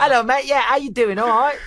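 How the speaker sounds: high, loud hammed-up English accent through laughter; fake English accent. Englishman laughing at himself greeting a mate, fake English accent. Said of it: "putting on a fake English accent".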